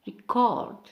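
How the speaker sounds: "record" as a verb, second syllable stressed. Shown as a demonstration. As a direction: say "record" as re-CORD. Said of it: "for verb we have".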